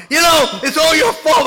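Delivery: hammed-up husband yelling at his wife; blaming husband. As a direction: shout blame at the wife.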